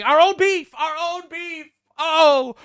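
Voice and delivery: high, loud mocking wail of a complaint; mock whining. Mocking a congresswoman complaining about beef, in a hammed-up, loud, high wail. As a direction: ham up her shrill outrage, loud and high. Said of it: "she continues bitching".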